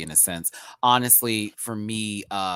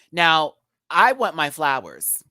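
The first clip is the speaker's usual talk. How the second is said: demanding; 4 semitones higher, 7 dB louder, slower than usual. Real, not acted. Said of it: "demanding flowers".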